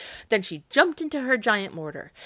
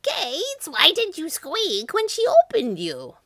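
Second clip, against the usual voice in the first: piercing, high witch shriek; furious shrieked accusation; Baba Yaga; 11 semitones higher, 6 dB louder, slower than usual. A narrator's reading. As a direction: shriek it at the top of your range. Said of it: "she shrieked".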